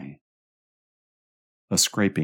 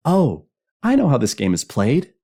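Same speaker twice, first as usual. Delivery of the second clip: put-on high, loud, gleeful child; excited kid bursting in; happy child's cry; 11 semitones higher, 8 dB louder than usual. A narrator's reading. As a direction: pipe up like a delighted child. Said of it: "some child cried happily in the dark".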